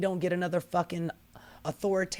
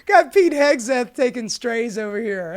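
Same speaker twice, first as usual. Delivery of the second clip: ordinary delivery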